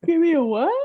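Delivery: loud, high incredulous question; taken aback, voice jumping up; startled question. Real, not acted. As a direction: blurt it out in real confusion, rising sharply. Startled by unfamiliar game name.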